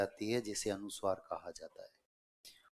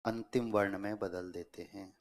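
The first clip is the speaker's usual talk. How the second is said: dictation. Real, not acted. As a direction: dictate phrase by phrase, waiting for writers. Dictates a rule for writing. (श्रुतलेख, रुक-रुककर बोलते हुए)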